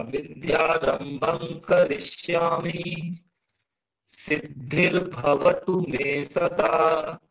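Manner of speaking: विद्यारंभं with "ng", chanted slowly; pronunciation model. Shown as a demonstration. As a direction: slowly intone विद्यारंभं करिष्यामि, vowels held long. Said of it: "तो क्या pronunciation हो जाएगा".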